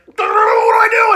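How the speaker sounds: very loud, high, drawn-out garbled shout; mock enraged roar. Driver giving up and driving away, exaggerated frustrated roar. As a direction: explode into a hammed-up howl of rage.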